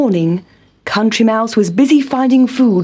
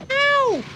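high little cry of pain; yelp; 6 dB quieter than usual. Acted. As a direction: squeak out a surprised Ow. Town Mouse falls.

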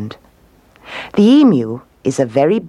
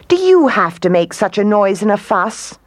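higher-pitched, mildly indignant complaint; annoyed; 6 semitones higher than usual. A narrator's reading. Queen kangaroo scolding the noisy emu, annoyed. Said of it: "The kangaroo was very annoyed".

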